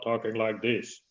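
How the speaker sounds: a hint of a low voice swallowed in the throat; mock sexy voice. Shown as a demonstration. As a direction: play it low and husky, lightly. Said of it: "like you maybe are a lot sexier".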